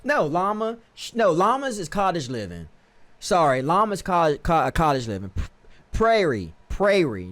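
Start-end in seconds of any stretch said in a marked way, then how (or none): 5.9-7.3 "prairie" said PRAY-ree; carefully corrected "prairie", PRAY-ree; corrected pronunciation. Real, not acted. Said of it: "There we go, prairie".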